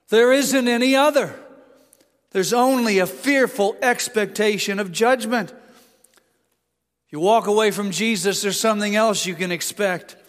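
mounting pulpit intensity; building preaching. Acted. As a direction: press the case harder and harder. Preaching at full force.

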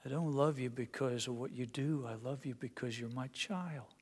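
soft, hushed father's reassurance; tender whisper-soft. Acted. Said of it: "father's words repeated tenderly".

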